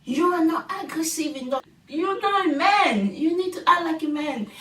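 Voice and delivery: lightly voiced scolding girlfriend; a hint of nagging partner; bossy girlfriend. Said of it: "she told Marsha".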